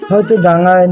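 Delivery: ordinary delivery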